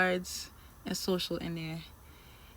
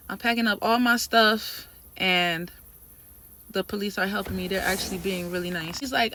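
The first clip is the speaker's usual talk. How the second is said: ordinary talk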